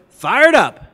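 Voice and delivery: loud shout back, falling at the end; crowd's answering yell. Retold or imitated. Whole room answers the woman, loud chant. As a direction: answer the chant at full volume. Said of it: "everyone in the room goes".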